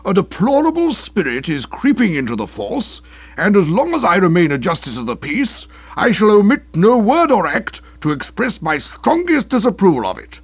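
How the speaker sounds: high, loud magistrate's tirade; strong remarks from bench. A narrator's reading. Magistrate condemning the police before his nephew, outraged. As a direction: thunder indignantly as if from the bench. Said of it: "give Stilton the strong remarks from the bench".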